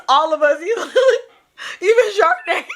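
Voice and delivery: high, helpless laughing voice; real giggling breakdown; crying with laughter. Real, not acted. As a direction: squeeze words out through laughter. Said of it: "I'm so crying".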